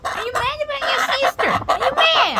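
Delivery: high, singsong voice for the dog; high pet talk. Real, not acted. Owner asking the sulking dog, high pet-talk voice. Talking sweetly to the pets.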